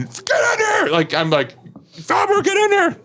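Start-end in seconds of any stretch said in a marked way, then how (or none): 0.0-0.9 high-pitched shouted order; imitated yell. Storyteller imitates the order he hears while dressing, high shout. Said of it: "I'm just, like, hearing". 1.9-3.0 high-pitched shouted summons; imitated yell. Storyteller imitates being ordered into the bunk room, high shout. Commander yelling for him.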